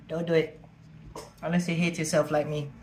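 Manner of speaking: ordinary talk, nothing marked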